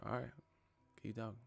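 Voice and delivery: hushed warning; quiet menace. Retold or imitated. Voicing a calm fighter's threat.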